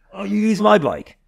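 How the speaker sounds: high, loud mock coach's order; imitated coach. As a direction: act out the coach, pitched right up. Coach's offer acted in retold dialogue.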